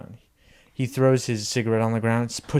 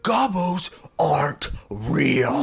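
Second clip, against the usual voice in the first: slow, breath-choked high growl; mock strained yell; 4 semitones higher, 3 dB louder, much slower than usual. Father about to beat son, slow strained mock yell. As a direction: force out each word separately.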